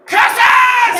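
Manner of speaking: high, loud cartoon-villain cry; villainous yell. Imitating dad after hitting his shin, villainous yell. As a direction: curse the heavens like a thwarted villain. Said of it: "raised his fist and just yelled".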